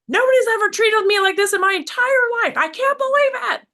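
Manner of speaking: high, loud indignant squeal; mock outrage. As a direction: play your own outrage as a whiny shriek. Mimics her own triggered outrage.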